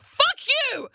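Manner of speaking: high, loud mock outrage at mum; teasing yell at her mother about her age; playful shout. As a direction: yell it back at mum in jest. Retorting to mum in retold story.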